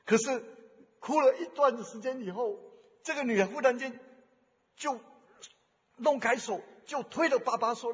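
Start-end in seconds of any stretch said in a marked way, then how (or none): none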